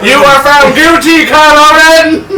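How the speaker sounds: high, loud judge proclamation; shouted verdict. Pretend. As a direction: declare it like a hammy judge.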